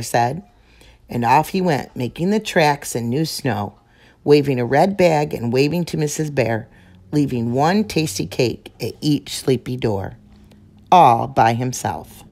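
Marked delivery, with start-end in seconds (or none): none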